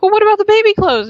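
eager questioning. Retold or imitated. Husband pressing his wife about baby clothes, eager and loud. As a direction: jump in eagerly, pitch up. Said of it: "And then he's like".

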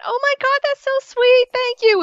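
very high, squealy delighted voice; hammed-up sugary thank-you for a sweet surprise; mock gushing girl. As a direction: squeal it like a thrilled girlfriend, way up high. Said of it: "that's her exact reaction is not".

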